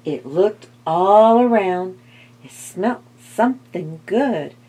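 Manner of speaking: ordinary delivery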